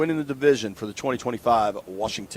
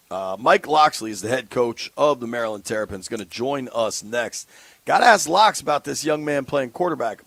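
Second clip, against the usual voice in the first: ordinary delivery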